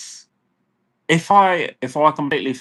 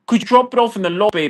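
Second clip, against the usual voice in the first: higher, rushed and snippy; fast mocking request; 8 semitones higher, faster than usual. Ranting about delivery drivers, quick mocking request. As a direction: rattle it off pointedly.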